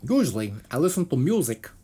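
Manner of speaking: put-on Minnesota accent. Podcaster reading bassist's quote on buying music, attempted Minnesota accent. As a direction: imitate a Minnesotan, not quite landing it. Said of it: "Is that how a guy from Minnesota talks?".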